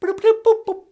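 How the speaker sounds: very high beeping noises; computer beeps. Acted. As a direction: beep like an old machine.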